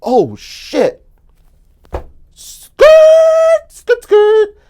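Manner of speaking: high, loud skidding-tire yell; panicked yell. Retold or imitated. Fleeing in panic, a high loud screech. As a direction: yell skirt like screeching tires. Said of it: "and I go".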